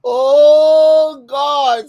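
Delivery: long, loud drawn-out groan; loud exclamation. Real, not acted. Overcome by a viewer's joke, loud exclamation. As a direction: wail it out after laughing. Recovering from a laughing fit.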